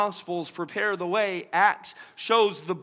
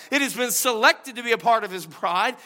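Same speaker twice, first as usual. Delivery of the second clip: ordinary delivery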